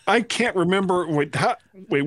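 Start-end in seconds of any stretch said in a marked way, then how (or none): none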